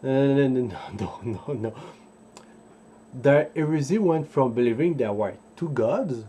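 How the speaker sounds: ordinary delivery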